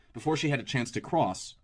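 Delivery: ordinary delivery, nothing marked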